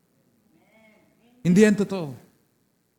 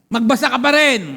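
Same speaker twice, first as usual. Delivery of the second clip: loud, higher preaching push; forceful exhortation; 4 semitones higher, 6 dB louder than usual. Real, not acted. Preacher urges congregation to keep reading even when sick, forceful exhortation. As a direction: drive the command home to the crowd.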